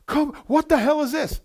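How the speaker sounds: high, loud, breathless rush of joy; hyperventilating with excitement. Retold or imitated. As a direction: shout for the manager, beside yourself.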